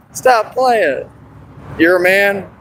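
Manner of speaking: ordinary delivery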